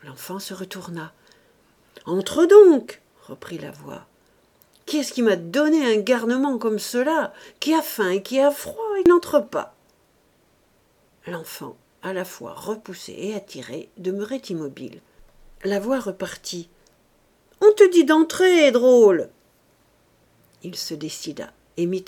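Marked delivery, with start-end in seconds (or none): 2.1-3.0 loud, high impatient command; exasperated yell; angry shout. Acted. Angry voice orders child inside. 4.9-9.8 loud, high, indignant tirade; angry complaint climbing higher; exasperated scolding. Acted. Angry voice scolds hesitating child. 17.6-19.3 very loud yell, high then dropping; furious, impatient shout; angry command. Acted. Angry voice repeats order to child.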